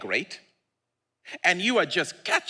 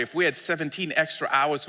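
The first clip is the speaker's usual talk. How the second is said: usual speaking voice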